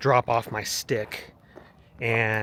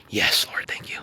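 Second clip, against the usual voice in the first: hushed, whispered thanks; whispered prayer; 4 semitones lower than usual. Real, not acted. Hiker thanks the Lord under his breath, whispered.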